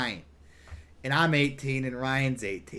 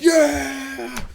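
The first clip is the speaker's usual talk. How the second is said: high whooping yeah; crowd cheer; 14 semitones higher, 7 dB louder than usual. Retold or imitated. The tent crowd cheering back at the narrator, high whoop.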